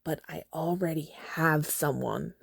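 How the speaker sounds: sharp, unvoiced hiss; hissed whisper. Katniss secretly protesting to Haymitch, hissed whisper. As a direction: hiss it through your teeth. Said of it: "I hiss at him".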